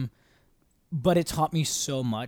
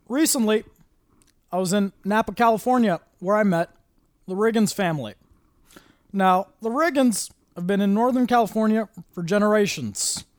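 higher presidential speech with long pauses; measured stump-speech delivery, raised pitch; Barack Obama voice; 8 semitones higher, 8 dB louder than usual. Retold or imitated. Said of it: "One of my favorites is Barack Obama".

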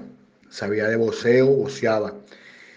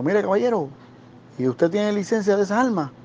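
imitating a stern supervisor, much higher; higher mock official asking about the gun; serious supervisor; 8 semitones higher than usual. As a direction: supervisor questions the guard about his gun license, higher. Retold serious supervisor questioning.

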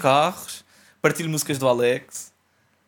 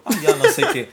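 mock dismissive voice; 11 semitones higher, 6 dB louder, faster than usual. Mocking own reaction.

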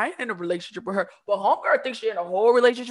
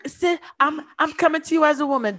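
higher, louder mock appeal; mocking imitation; 6 semitones higher, 5 dB louder than usual. Host mimicking a woman calling her about a man, mocking. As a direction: put on a raised, woman-to-woman voice.

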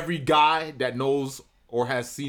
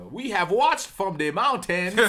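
put-on slow, pitched-up Wakandan accent; Wakandan God voice; 5 semitones higher, slower than usual. God watching from the mountains, slow Wakandan accent. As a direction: proclaim it slowly as a Wakandan god. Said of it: "I give my God the, um, the Wakandan accent".

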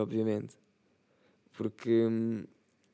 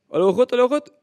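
loud shout; 12 semitones higher, 9 dB louder, much faster than usual. Real, not acted. Announcing a burp.

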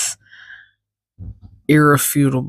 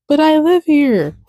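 cat's voice; 11 semitones higher than usual. Pretend. Voicing the cat's reply.

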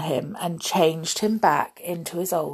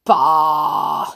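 loud, drawn-out grumpy outburst; loud exclamation of contempt; snorting disdain; 2 semitones lower, 6 dB louder than usual. A narrator's reading. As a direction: snort it out scornfully. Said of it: "he snorted".

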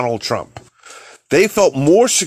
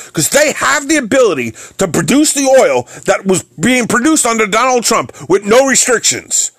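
indignant rant; 6 semitones higher than usual. Real, not acted. Ranting at oil companies.

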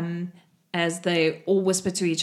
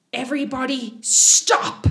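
raised voice, slow and emphatic; commanding call; 4 semitones higher, 5 dB louder, slower than usual. Acted. Game master as Pia halting the gnomes, commanding call. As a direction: call a crowd to a halt, hitting the last word.

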